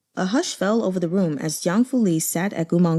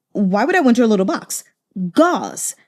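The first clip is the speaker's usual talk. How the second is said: sharp impatient snap, a bit louder; snapped; 2 semitones higher, 3 dB louder than usual. Acted. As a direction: bite off the demand. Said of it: "Jiang Fu Li snapped".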